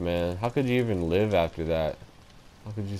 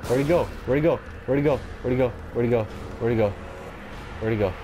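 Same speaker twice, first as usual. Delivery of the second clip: frantic, repeating it faster and faster; panicked; 4 semitones higher, much faster than usual. Real, not acted. Panicking during zombie fight.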